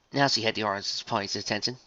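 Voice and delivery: ordinary delivery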